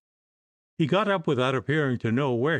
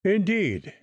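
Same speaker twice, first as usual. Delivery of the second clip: ordinary delivery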